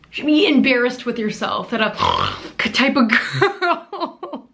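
slow words collapsing into a gasp of laughter; cracking up mid-line; laughing through it. Real, not acted. Mocking Lundy's fans, breaking into laughter.

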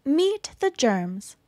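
usual speaking voice

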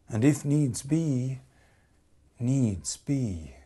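dramatic poetry reading; poem recitation. Acted. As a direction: recite it like a love song spoken aloud. Whole piece a recited love poem.

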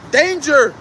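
warning yell. Shown as a demonstration. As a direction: call it out like an alarm. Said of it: "you're yelling".

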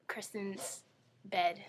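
usual speaking voice

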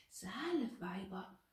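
slow, anxious echo; mildly frightened, drawn-out repetition. A narrator's reading. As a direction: repeat it slowly with a touch of fear. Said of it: "wiederholte Gertrud ängstlich".

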